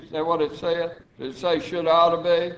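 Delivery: ordinary talk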